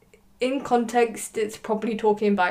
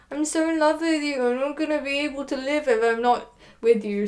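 higher-pitched, hammed-up pleading; mock lovesick Helena; 5 semitones higher than usual. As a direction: imitate a clingy lover, pitched up. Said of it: "She's just a bit petty, and she's like".

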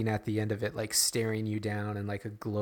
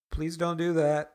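muffled through mask; 5 semitones higher than usual. Retold or imitated. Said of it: "muffled through the mask being like".

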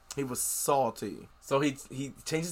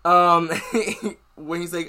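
ordinary delivery

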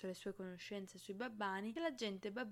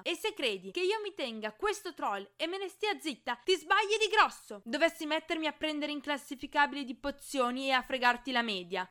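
high, loud, raging threat; furious shouting; 7 semitones higher, 12 dB louder than usual. A narrator's reading. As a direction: scream it at him, out of control. Hermione screaming at Draco.

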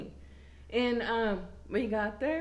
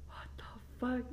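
a much softer, breathless whisper, "fuck" barely voiced; whispered what the fuck; 10 dB quieter than usual. Quoting her boyfriend's shocked whisper at the mess, hushed. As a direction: hiss it under your breath, shocked.

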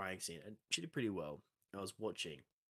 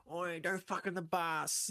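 much higher, slow put-on addict; hammed-up druggie on the bus; crackhead voice; 9 semitones higher, slower than usual. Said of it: "crackhead impression".

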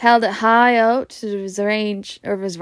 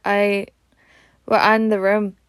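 usual speaking voice

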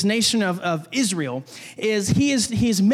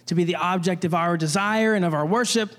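normal talking voice

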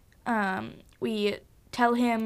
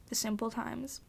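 wistful hush; 13 dB quieter than usual. Real, not acted.

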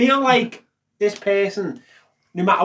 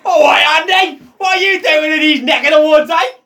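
higher, loud matey hello; hearty shouted greeting; 9 semitones higher, 7 dB louder than usual. Acted. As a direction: call out to him like a pub regular. Greeting mate in put-on voice.

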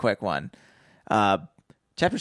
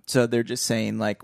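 usual speaking voice

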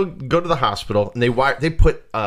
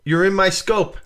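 higher, louder mock complaint; higher and louder, mocking a viewer begging for attention; whiny demanding user; 7 semitones higher, 4 dB louder than usual. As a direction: act out the needy guy who wants a hello. Mimicking a demanding chat user.

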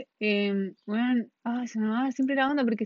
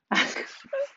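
high breathy embarrassed laugh; squealing laugh. Real, not acted. Embarrassed by her answer, squealing laugh.

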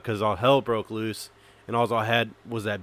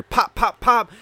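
higher, punched "pop, pop, pop"; imitated gunshots; 12 semitones higher, 7 dB louder, much slower than usual. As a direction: fire off sharp popping shots.

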